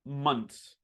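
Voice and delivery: Months said as mons, th dropped; casual mons; months without the th. Shown as a demonstration. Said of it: "I pronounce this word like this".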